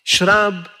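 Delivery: offering a drink. Retold or imitated.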